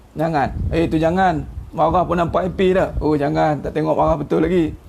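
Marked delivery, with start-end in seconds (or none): none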